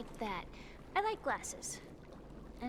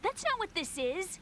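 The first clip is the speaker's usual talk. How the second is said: angry denial; 11 semitones higher than usual. Acted. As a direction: shut the stranger down. Sister rejects stranger's remark.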